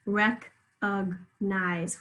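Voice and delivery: recognize very slowly as "rec-ug-nize"; syllable by syllable. Shown as a demonstration. Teacher has pupils hunt the schwa, syllable by syllable. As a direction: break recognize into three slow syllables. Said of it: "Where do you hear it?".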